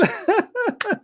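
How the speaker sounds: high, soft tail of a mocking cackle; devil's cackle. Shaitan finishing his laugh, fading high cackle. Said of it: "he laugh".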